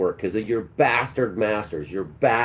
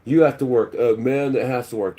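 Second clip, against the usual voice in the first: mocking the video guy; 3 semitones higher, 3 dB louder than usual. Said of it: "what this idiot has".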